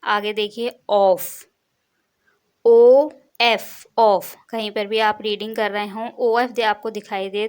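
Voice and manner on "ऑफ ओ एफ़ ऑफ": ऑफ loud, then ओ एफ़ spelled slowly and very loud; loud spelled model. Shown as a demonstration. Teacher drilling pupils, loud slow spelling. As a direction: belt out the spelling for the class. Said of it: "उसकी pronunciation होगी".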